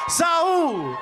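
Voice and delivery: long stretched shouted fighter name; drawn-out name call. Acted.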